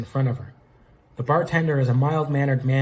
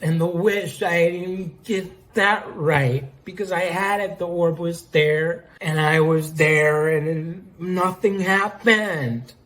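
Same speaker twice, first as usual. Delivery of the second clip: put-on higher, drawn-out drunken lament; tongue-tripping slur; 5 semitones higher, slower than usual. A narrator's reading. Ruby rambles to Amingo about the wish, slurred drunken complaint. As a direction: wail it slowly through a thick tongue. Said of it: "tripping over her own tongue".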